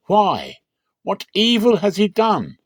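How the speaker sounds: high, loud pleading questions; exasperated governor. A narrator's reading. Pilate pushing back at the crowd, high and loud.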